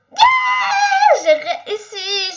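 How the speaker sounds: very high, loud yes then laughing; triumphant shout. Real, not acted. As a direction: shout the win out. Finally succeeds level.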